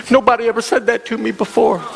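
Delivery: mock sobs leading into the words; imitating tearful speech. Depressed caller to pastor on phone, imitated tearfulness. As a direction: act out a man talking through tears. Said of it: "through his tears, he said".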